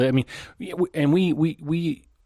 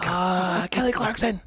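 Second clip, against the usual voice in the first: a silly, pitched-up exclamation; comic cry; 7 semitones higher, slower than usual. Pretend. Comic cry after mock yelling.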